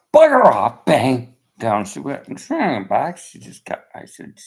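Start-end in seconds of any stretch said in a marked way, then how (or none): 0.1-0.9 loud, higher bark of retold anger; retold shout. Retelling the call to the landlord, loud shout. Retold phone quarrel with woman. 0.9-1.4 loud imitated slam; loud mock bang. Retelling how the call ended, loud mock bang.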